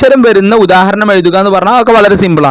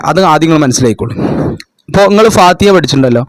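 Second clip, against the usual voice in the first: usual speaking voice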